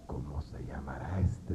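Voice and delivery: secret unvoiced whisper, wondering; whispered thought. Whispering his doubt about the motivator. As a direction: hush it like a private thought.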